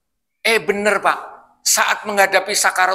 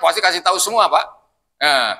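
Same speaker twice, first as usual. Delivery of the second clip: usual speaking voice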